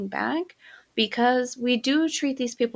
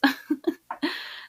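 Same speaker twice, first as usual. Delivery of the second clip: breathy giggle; 6 semitones higher than usual. Real, not acted. Laugh after whispered brag.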